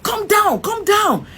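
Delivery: high shouted command; retold officer shout. Armed officers ordering her out, high shout. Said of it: "And they're like".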